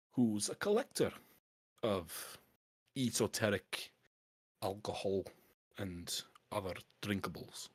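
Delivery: canny Scottish dwarf dealer. Acted. Dwarf pitches smuggling bottles to a collector, canny Scottish dwarf. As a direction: sell the scheme in a Scots dwarf voice. Game master voices dwarf.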